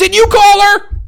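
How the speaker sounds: very high, loud mock outrage; mock outraged Lex. Lex confronting Clark about the doctor, very high loud mock shriek. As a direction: shriek the question in disbelief. Mocking Lex's shock.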